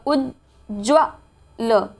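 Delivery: Ujjwal slowly split "uj - jva - l"; syllable by syllable. Shown as a demonstration. Teacher breaking down the spelling, slow separate syllables. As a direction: pause between each part of Ujjwal. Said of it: "क्या होगा".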